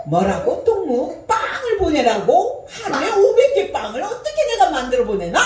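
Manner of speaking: high, loud incredulous phone voice; mimicking the woman's shocked phone call, high and loud; North Korean woman on the phone. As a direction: shout down the line in disbelief. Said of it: "그래서 그때 통화 내용이 뭐냐면".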